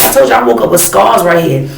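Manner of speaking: ordinary delivery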